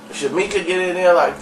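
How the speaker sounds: ordinary delivery, nothing marked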